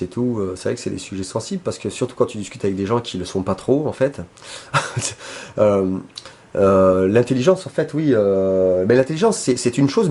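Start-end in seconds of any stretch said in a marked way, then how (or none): 4.5-5.5 brief, soft, breathy chuckle; quick low-key laugh to yourself; short laugh. Real, not acted. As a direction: chuckle quietly at your own remark. Amused by own remark on touchy subject.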